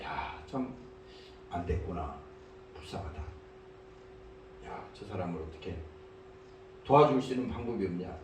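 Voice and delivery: low, very soft pitying murmur; hushed, heartfelt sigh of sympathy; compassionate musing. Acted. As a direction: think aloud softly, full of pity. Voicing compassion for a pitiful person.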